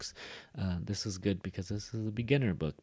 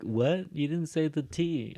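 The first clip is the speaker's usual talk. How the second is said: puzzled student; 6 semitones higher, slower than usual. Acted. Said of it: "And you're like".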